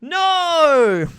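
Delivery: high, drawn-out yell; loud cry of no. Real, not acted. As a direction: wail one long, falling "no". Streamer's dismayed outburst mid-game.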